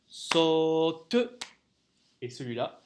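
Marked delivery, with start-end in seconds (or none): 0.1-1.2 loud "saute" split into long "sau" and short "te"; rhythmic syllables. Shown as a demonstration. Teacher names the saute in rhythm, chanted syllables. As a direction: hold "sau" one beat on a level pitch, drop "te" after it. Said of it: "celui-ci dure un temps".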